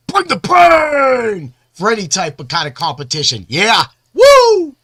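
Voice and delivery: building to a loud whoop; hype shout. Real, not acted. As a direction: pump up the crowd, yelling. Hyping himself up, shouting.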